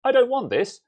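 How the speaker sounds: high, loud mock brush-off; dismissive quote. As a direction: toss it aside like a picky buyer. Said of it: "been like".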